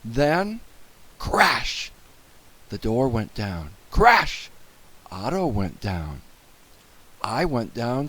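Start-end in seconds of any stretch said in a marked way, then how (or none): 1.2-1.9 high, sudden exclamation; dramatic crash. A narrator's reading. Narrator voices a crash. 3.9-4.5 high, loud burst; dramatic crash. A narrator's reading. Narrator voices a crash.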